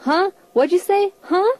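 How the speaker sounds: can't hear, asking again; straining to hear. Retold or imitated. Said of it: "all I say is".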